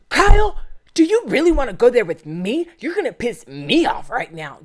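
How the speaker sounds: high, loud, hammed-up angry threat; mocking Amanda. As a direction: play Amanda losing her temper at Kyle. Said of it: "Amanda says".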